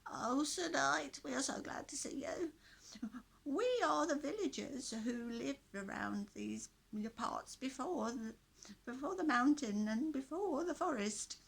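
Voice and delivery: put-on high, loud, creaky old villager voice; croaky old man. A narrator's reading. Old villager pleads with the knight to free them, croaky. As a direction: croak it out like a frail old prisoner. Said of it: "in a very croaky voice".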